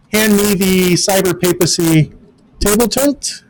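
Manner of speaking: ordinary delivery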